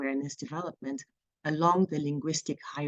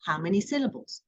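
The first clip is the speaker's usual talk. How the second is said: ordinary talk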